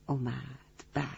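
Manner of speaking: soft echo. Shown as a demonstration. Storyteller echoes اومد softly for the children. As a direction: let اومد fade like an echo. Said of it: "repeated word, second take differs".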